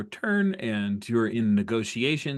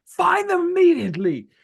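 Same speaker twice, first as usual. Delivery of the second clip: very high, loud frantic commander; screaming orders; 8 dB louder than usual. Acted. Captain screaming at the bridge crew to find someone, frantic yell. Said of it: "he's screaming at them".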